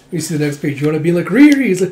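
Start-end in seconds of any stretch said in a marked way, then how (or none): 1.3-1.7 high, loud joyful call of a friend's name; thrilled reunion shout; excited cry. Retold or imitated. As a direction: call out Riri delightedly across the room.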